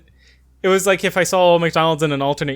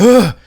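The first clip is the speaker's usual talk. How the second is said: loud, high exasperated "ugh"; annoyed groan; 9 semitones higher, 13 dB louder than usual. Retold or imitated. Pharmacist grabbing a pen, imitated annoyed groan. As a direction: imitate a fed-up huff, loudly. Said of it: "he's, like, so annoyed".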